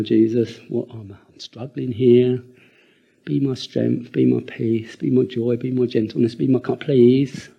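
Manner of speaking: a preacher praying aloud, pleading; earnest prayer. Acted. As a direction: call on Jesus for strength. Personal prayer for help.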